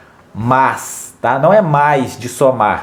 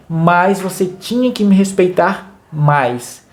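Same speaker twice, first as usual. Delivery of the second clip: slow, higher "Mas" pressed hard; stress on mas; 3 semitones higher, slower than usual. Shown as a demonstration. Parent to son, slow with Mas stressed. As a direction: land on the word "Mas" slowly. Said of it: "quando você fala".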